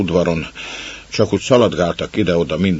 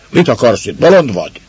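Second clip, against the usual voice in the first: loud, higher stunned scolding; astonished growl; 5 semitones higher, 7 dB louder than usual. Acted. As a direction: bark at the intruder in disbelief. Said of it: "elképedve mordultak rá".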